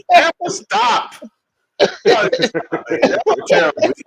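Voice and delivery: very high, drawn-out squeal through laughter; helpless laughing. Real, not acted. Begging co-host to stop the joke, helpless laughing. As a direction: protest while helpless with laughter.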